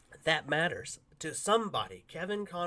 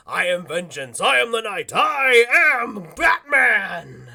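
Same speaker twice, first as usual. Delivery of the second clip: Batman line; 7 semitones higher, 13 dB louder, much slower than usual. Retold or imitated. Conroy proving he is Batman to the kitchen guy, slow and booming. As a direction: boom it out like the Dark Knight. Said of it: "he does his famous line".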